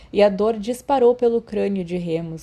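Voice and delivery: usual speaking voice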